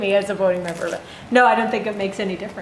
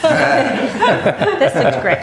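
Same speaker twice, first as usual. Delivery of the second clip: laughing; 5 semitones higher, 4 dB louder, much slower than usual. Real, not acted. Joking about unvoteable agenda.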